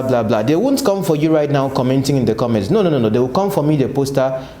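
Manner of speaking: normal talking voice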